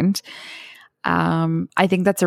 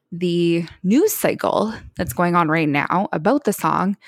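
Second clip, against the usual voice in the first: normal talking voice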